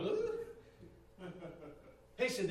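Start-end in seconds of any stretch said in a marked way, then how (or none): 0.0-1.3 high, pained comic groan with a laugh; mock groan. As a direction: let out a stunned little groan. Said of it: "He was like".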